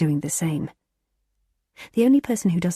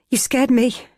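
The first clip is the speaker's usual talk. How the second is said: startled; 7 semitones higher, 5 dB louder than usual. A narrator's reading. Startled by boyfriend.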